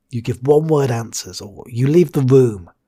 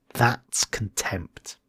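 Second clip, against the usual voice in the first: dramatic whisper; 3 semitones lower, 6 dB quieter, much slower than usual. Shown as a demonstration. Dramatic reveal after example.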